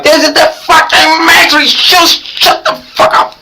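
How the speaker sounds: a high-pitched mock shout at the neighbors; squeaky mock yell. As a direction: squeal it back like a manic prophet. His own retold reply.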